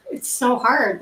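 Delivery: normal talking voice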